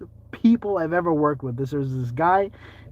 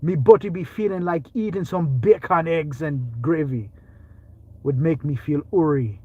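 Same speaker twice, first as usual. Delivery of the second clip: hammed-up Jamaican patois; Jamaican accent. Comic craving bacon, eggs and gravy, mock Jamaican accent. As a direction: lilt it in a put-on Jamaican accent. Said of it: "try Jamaican".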